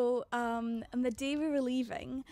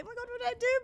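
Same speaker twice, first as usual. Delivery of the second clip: high frantic plea; panicked; 13 semitones higher, 3 dB louder, faster than usual. Retold or imitated. Girl at airport security begs family, high and frantic. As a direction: flap about what to do, much higher.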